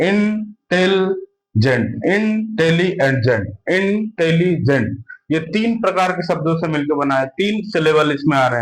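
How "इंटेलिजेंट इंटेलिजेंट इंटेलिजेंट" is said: Intelligent spoken very slowly, syllables pulled apart. Shown as a demonstration. Teacher showing students the syllables, slow syllable by syllable. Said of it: "इसमें कितने syllable आ रहे हैं?".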